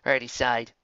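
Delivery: ordinary delivery, nothing marked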